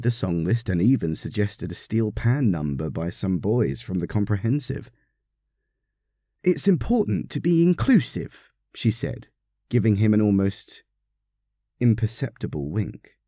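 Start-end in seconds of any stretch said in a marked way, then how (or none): 6.4-8.5 high-pitched lady with a knowing lilt; knowing parishioner. A narrator's reading. Parishioner hinting to the Reverend, high and knowing. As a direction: say it high, with a hidden wink. Carol speaks with knowing wink.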